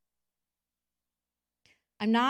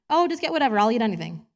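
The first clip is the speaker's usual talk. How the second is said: eager-to-please reply; 3 semitones higher, 8 dB louder, faster than usual. Retold or imitated. Her old self deferring.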